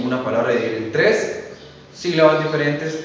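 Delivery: ordinary talk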